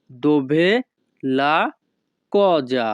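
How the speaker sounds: "Dove", "la", "cosa" said apart with pauses; word by word. Shown as a demonstration. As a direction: split the phrase into separate words.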